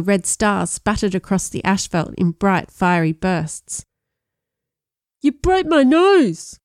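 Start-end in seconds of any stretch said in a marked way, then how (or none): 5.2-6.6 high, loud, slow nasal cry; nasal accusing. A narrator's reading. Brian accuses Sean after the punch, nasal shout. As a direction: yell it through a broken nose. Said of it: "Brian accused in a nasal voice".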